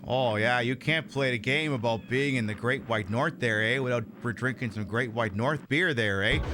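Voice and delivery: mock Canadian accent. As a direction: play the cliché Canadian offering a Labatt's. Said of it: "I gotta make this game even more Canadian".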